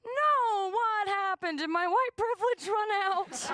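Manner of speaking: high, loud fake outrage; mock shock. Herself reacting to arrest, high mock shock. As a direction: play innocent disbelief.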